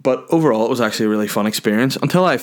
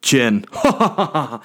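real laugh; 5 semitones higher than usual. Real, not acted. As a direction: burst of laughter after the joke. Laughs at own joke.

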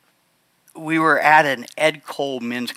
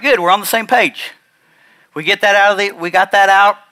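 louder, higher, forcing the point with a friend; half-mocking his own blunt reply; emphatic retold declaration; 7 semitones higher, 9 dB louder than usual. Telling his angry friend they're finally even, loudly.

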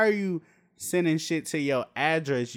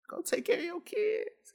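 much higher, soft mocking singer voice; high, soft sneering taunt at a diva; 5 dB quieter than usual. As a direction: talk down to her in a squeaky mock voice.

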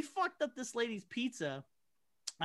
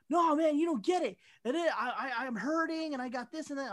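mock whiny customer; 6 semitones higher, 3 dB louder than usual. Whiny customer quoted in story.

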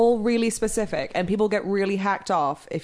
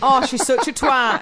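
high, loud northern English moan; 6 semitones higher, 5 dB louder than usual. Acted. Hen-do reveller slagging off the bride, high northern English. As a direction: gossip indignantly in broad northern English. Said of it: "Before the northerner comes out".

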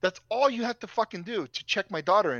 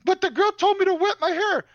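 put-on high, whiny child's voice; imitating his daughter; 11 semitones higher, 5 dB louder than usual. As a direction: plead like a small girl caught out. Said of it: "she's like".

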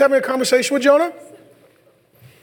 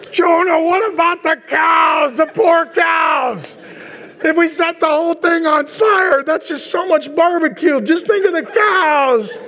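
higher, slow, shouted plea half laughing; mock pleading God; 8 semitones higher, slower than usual. God pleading with Jonah about the cows, hammed-up and slow. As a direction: ham up a worried plea for the cows.

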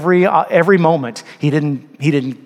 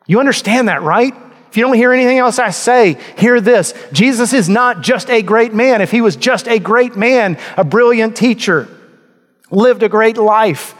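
higher, louder fervent preaching; preaching at full force; 8 semitones higher, 3 dB louder than usual. Acted. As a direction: drive the point home to the congregation. Sermon climax at full force.

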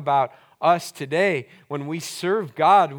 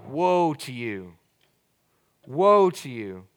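gentle lament; slower than usual. Shown as a demonstration. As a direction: sigh it out with disappointment. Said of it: "when we hear it in English".

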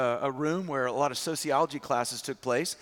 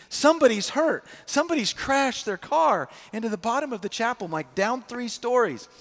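higher, louder alarmed cry; panicked; 5 semitones higher, 4 dB louder than usual. Retold or imitated. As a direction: sound the alarm, someone's hurt. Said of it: "And they're panicked".

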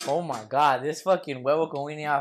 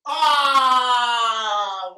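loud pained cry; 6 semitones higher, 9 dB louder than usual. Real, not acted. Reacts to losing the verdict.

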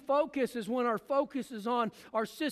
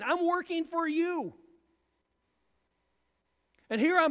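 anxious complaint; 5 semitones higher than usual. Acted. Preacher voicing Martha complaining to the Lord about her sister, anxious and higher. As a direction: play a frazzled woman begging for help with the chores. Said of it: "Can you hear the anxiety in Martha's voice?".

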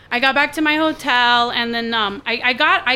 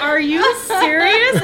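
slow, high, stretched-out disbelief; incredulous; 4 semitones higher, 4 dB louder, slower than usual. Retold or imitated. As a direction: ask it in stunned amazement. Retells her disbelief at them.

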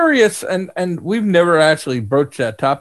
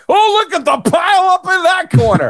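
hammed-up traffic reporter spotting a crash; 12 semitones higher than usual. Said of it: "he could be the new D C belt traffic, Beltway traffic guy".

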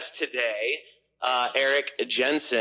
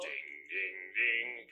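low ding sound; 4 semitones lower, 11 dB quieter, slower than usual. Shown as a demonstration. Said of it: "it should be more deeper".

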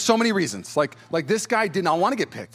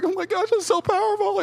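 much higher, gushing with emotion; gushing overwhelmed. Retold or imitated. Crier at church, gushing much higher. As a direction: spill over like a crier at a movie.

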